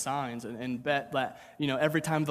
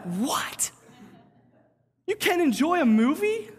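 much higher and louder, mock shocked; incredulous disbelief; 11 semitones higher, 5 dB louder, slower than usual. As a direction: squeak it out in exaggerated disbelief. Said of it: "And I'm like".

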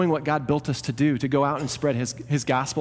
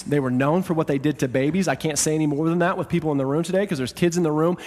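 ordinary talk

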